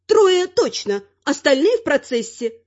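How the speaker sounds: high-pitched granny reply; boasting granny. A narrator's reading. Granny brags to troll woman.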